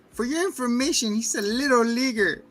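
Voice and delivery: high-pitched put-on kid voice; indignant little sister. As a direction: defend big brother in a high mock-huffy voice. Voices little sister defending brother.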